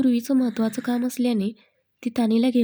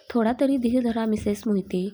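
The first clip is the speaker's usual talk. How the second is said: ordinary delivery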